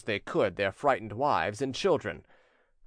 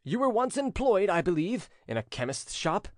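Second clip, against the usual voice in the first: severe; 7 semitones higher than usual. A narrator's reading. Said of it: "said the prince in a severe tone".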